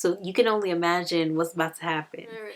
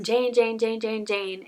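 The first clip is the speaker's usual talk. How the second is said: higher-pitched, repeated mock call; mock yelling; 4 semitones higher, slower than usual. Rochester calls Jane down, late for the wedding, mock yelling. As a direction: imitate hollering for someone, without full volume. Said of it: "like, yelling down for Jane".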